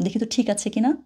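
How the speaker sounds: ordinary talk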